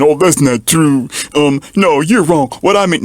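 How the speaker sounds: a hint of whiny protest; imitating women talking back; mock women objecting.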